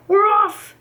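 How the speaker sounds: high, loud call; shouted. A narrator's reading. Men setting off with the cart, loud shout. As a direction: shout it out to the crew. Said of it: "the men shouted".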